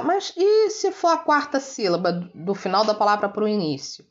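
teacher voicing a student's question. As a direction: pipe up with a puzzled pupil's objection. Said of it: "aí você pode me perguntar".

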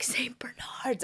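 whispered and slow, a hushed name; dad's reverent whisper. Retold or imitated. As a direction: breathe the name like something sacred. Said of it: "the dad is like".

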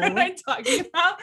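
fast, high, laughing. Real, not acted. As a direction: laugh at yourself quickly. Laughs at herself.

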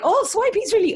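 high-pitched eager gushing; retold giddy first reaction; excited. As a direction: gush it high, then catch yourself. Re-enacting eager swipe before realizing.

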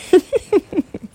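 short sheepish giggle; embarrassed laugh. Real, not acted. Host laughing at her own yawn, sheepish giggle.

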